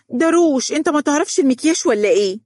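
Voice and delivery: put-on a little girl showing off her makeup; proud and confident. A narrator's reading. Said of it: "بزهو وهي تقول بثقة كبيرة". (بزهو وثقة طفولية)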